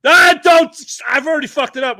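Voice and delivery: high, loud yelp cutting someone off; alarmed protest. Real, not acted. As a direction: shout to stop them, pitch shooting up. Stops co-host saying forbidden word.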